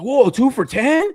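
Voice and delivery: imitating a clueless shopper's amazement; mock gullible shopper. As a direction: play a sucker dazzled by a sale sign. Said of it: "people are like".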